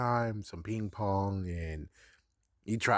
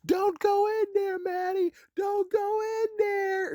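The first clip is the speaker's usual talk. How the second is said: put-on much higher and louder, slow shrieking old woman; grandma's warning shout; 5 dB louder than usual. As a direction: shriek it like a frantic grandma from the film. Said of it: "the grandma acts like".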